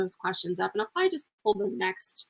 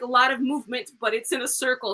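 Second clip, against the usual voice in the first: ordinary talk, nothing marked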